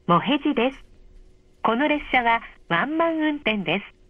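ordinary talk, nothing marked

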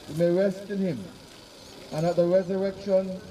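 ordinary delivery

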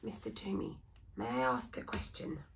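soft timid whisper to the carver. Acted. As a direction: ask under your breath, not to disturb. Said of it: "cleared his throat and whispered".

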